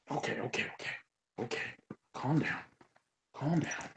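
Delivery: soft, high hush to the dog; a soft, high calm down to the dog; soothing the dog. Real, not acted. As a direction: settle the dog down gently.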